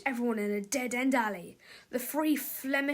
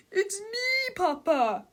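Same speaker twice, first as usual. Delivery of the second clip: put-on high childish plea; pleading cry; 7 semitones higher, much slower than usual. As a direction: beg your father to know you. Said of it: "cried Mister Krupp".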